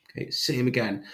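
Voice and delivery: normal talking voice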